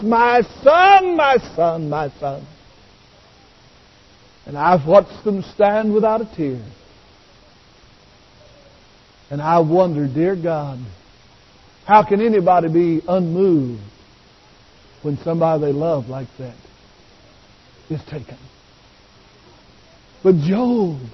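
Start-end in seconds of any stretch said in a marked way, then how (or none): 0.0-2.5 retold anguished cry. Preacher voicing grieving parents at the graveside, anguished cry fading away. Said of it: "screamed at the top of their lungs". 4.5-18.4 low, soft, grave wondering; hushed preaching. Real, not acted. Preacher wondering how mourners stay unmoved, hushed and low.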